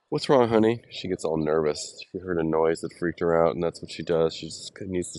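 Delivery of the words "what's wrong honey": gentle, higher comforting voice; soothing a pet. Real, not acted. Comforting his trembling dog.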